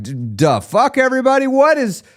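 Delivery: alarmed executive yelling at the board; panicking CEO. Retold or imitated. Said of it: "the C E O of your life is showing up".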